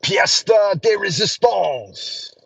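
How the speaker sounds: hammed-up French accent. Shown as a demonstration. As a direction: savor each word in French. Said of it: "I know a few French words".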